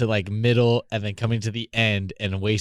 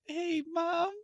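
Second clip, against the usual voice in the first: put-on much higher, little-kid voice; sweet innocent child voice; 7 dB quieter than usual. Son asking his mom for something, in a much higher child voice. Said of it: "that sweet little innocent child voice".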